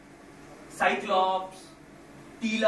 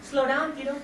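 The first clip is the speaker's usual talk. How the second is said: higher, louder, yelping pop star; 9 semitones higher, 9 dB louder, slower than usual. Retold or imitated.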